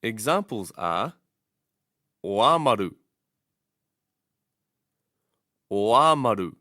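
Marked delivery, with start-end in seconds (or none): 2.2-6.5 "oamaru" with a long, loud AA; stress first long vowel. Shown as a demonstration. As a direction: say "oamaru" as o-AAH-ma-ru. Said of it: "stress the first long vowel".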